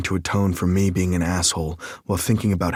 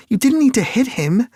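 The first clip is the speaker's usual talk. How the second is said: much higher, louder female voice scolding; Lake scolding; 7 dB louder than usual. A narrator's reading. Lake reproaching Nick for hitting the man, high and loud.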